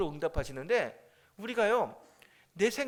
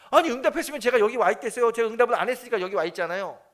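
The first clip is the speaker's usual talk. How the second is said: loud, higher-pitched exasperated comeback; acted indignant retort; 5 semitones higher, 9 dB louder than usual. Drowned churchgoer arguing back at Jesus, acted indignant retort. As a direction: argue back in mock outrage.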